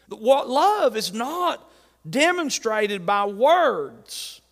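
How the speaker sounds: slow, weighty, each word placed; slow emphatic. Real, not acted. Preacher to congregation, slow and weighty. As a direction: lay it down slowly for the church. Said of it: "slowed emphatic sermon point".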